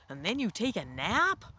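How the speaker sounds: usual speaking voice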